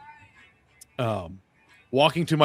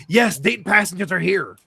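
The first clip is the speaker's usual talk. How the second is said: higher, louder, testy retort; imitated pissy lady; 7 semitones higher, 5 dB louder than usual. Gate lady to waiting passengers, mock pissy. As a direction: mimic an irritated woman insisting. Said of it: "Lady's getting pissy".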